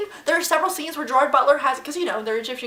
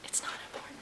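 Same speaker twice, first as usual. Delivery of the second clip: whisper; 19 dB quieter, much faster than usual. Real, not acted. Aside while friend takes a call.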